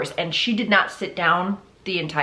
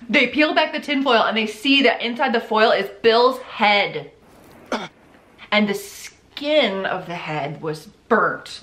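usual speaking voice

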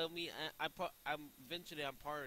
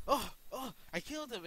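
re-enacted cry, higher and louder; retold exclamation; 9 semitones higher, 8 dB louder than usual. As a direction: replay your shocked outburst. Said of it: "I'm like".

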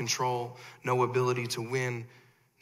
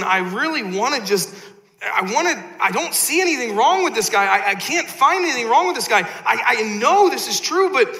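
louder and higher, building to a near shout; torn Pilate rising; 9 semitones higher, 8 dB louder than usual. Acted. Pilate, torn over Jesus before the crowd, building loud protest. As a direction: Pilate arguing with himself, voice climbing. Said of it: "and you're like Pilate".

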